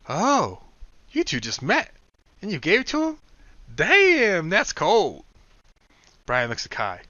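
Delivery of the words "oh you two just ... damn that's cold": much higher, louder scandalized gasp; Will's mock shock. Will judging Kai for giving away the coin, loud and high. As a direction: act scandalized.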